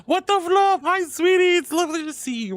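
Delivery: high, loud squeal of welcome; high-pitched happy surprise; excited greeting. Real, not acted. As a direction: greet a favourite with sudden delight. Excited greeting to arriving viewer.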